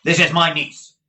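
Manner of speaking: loud scornful uncle; scornful Ralph. A narrator's reading. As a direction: cut in with contempt. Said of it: "with such scorn".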